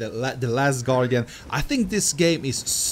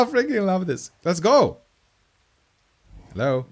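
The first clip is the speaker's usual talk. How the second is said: normal talking voice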